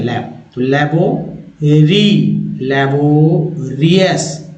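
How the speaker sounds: लैबोरियस built slowly from लैब to लैबो री; slow build-up. Shown as a demonstration. Teacher to class, slow buildup of लैबोरियस. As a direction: piece लैबोरियस together slowly. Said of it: "लैब जानते हैं ना".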